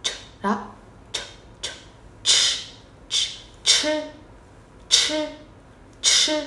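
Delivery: "ch" thì thầm bật mạnh hơi; "ch" đẩy hết hơi ra, không thanh. Shown as a demonstration. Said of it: "C H này". (phụ âm "ch" bật hơi kiểu tiếng Trung)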